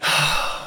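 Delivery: long breathy exhale; weary sigh. Real, not acted. Comedian asked how it got worse, sighing heavily. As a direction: let out a heavy sigh.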